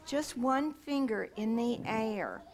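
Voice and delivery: ordinary talk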